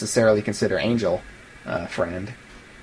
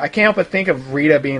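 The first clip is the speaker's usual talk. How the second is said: normal talking voice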